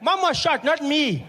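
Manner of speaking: put-on high, loud child's voice; little brother voice. Young brother telling about mom, high child voice. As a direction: play the little brother blurting it out.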